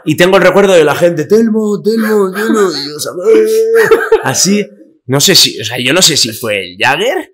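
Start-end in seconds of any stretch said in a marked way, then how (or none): 1.2-2.6 higher, calling his name across the river; half-mock call of his name, pitched up. People on the riverbank calling to the drunk Telmo, higher calling voice. 2.7-4.6 higher, slow, laughing at his dazed self. Retold or imitated. Retelling how he stood stuck in the mud, slow laughing daze.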